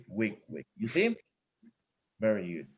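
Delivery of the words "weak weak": "weak, weak" dropped low, much softer; soft weak beats. Shown as a demonstration. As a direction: breathe out "weak, weak" barely audible. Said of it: "So it's down with".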